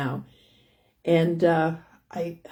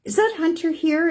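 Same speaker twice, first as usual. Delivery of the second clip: high, louder playful granny call; playful pretend wondering; 12 semitones higher, 4 dB louder than usual. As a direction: pitch it way up, pretending not to know. Acting out hide-and-seek with grandson.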